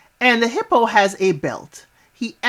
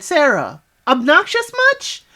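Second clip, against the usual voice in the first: sassy jab; 6 semitones higher than usual. Pretend. Sassy retort to contestant.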